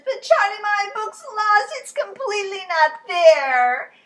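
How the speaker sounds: high, loud, slow protest; distressed little girl; upset Lola. Acted. Lola protesting to Charlie, high and loud.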